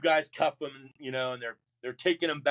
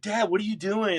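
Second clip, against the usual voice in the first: high-pitched retold exasperation; dismayed, reproachful question; exasperated scolding; 7 semitones higher, 4 dB louder than usual. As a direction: imitate a child scolding a parent, pitched up. Retold scolding of his father.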